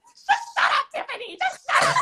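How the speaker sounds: high-pitched mock snapping; retold exasperated outburst. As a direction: cut her off, acting out the quarrel in a high voice. Retold snapping at Tiffany in the boat.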